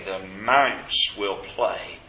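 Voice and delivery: ordinary talk, nothing marked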